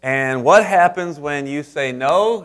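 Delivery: usual speaking voice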